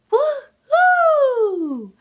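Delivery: high, gleeful woohoo cheer; excited woohoo. Real, not acted. Excited cheer at reaching the farm.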